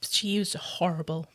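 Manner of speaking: hushed, soft verdict; disgusted whisper. Real, not acted. Judging Karen after the story, whispered in disgust.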